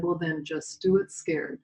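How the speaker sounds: soft, gentle dad reassuring his daughter; kind fatherly coaxing. Retold or imitated. Father coaxing his scared little girl at the post, soft and gentle. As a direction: ease her past the fear, lightly voiced. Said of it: "He looked at me with his kind eyes".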